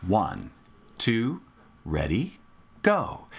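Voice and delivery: slow, evenly spaced low count, rising on go. Shown as a demonstration. Teacher cueing students to start together, measured count-off. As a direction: count them in on a steady beat. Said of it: "I'll count off again".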